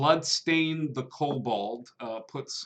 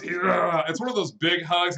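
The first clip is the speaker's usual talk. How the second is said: ordinary talk, nothing marked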